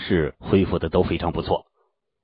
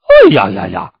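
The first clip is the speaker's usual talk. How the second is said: waking yelp; 11 dB louder than usual. Acted. Noisy disciple stirring awake, loud waking yelp.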